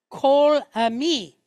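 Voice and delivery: "kol ami" loud and slow, O in "kol"; O sound. Shown as a demonstration. Teacher showing the phrase for all my people, O sound. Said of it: "that is an O sound".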